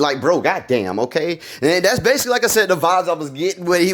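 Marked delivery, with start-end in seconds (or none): none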